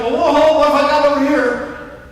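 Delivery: a startled husband's exclamation; surprised. Pretend. Voicing husband surprised by wife.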